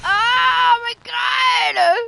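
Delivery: very high, loud, hugely stretched oh my god; drawn-out shout. Real, not acted. Streamer shouting at her blood web, very high and long. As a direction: hold the oh for ages at the top of your voice.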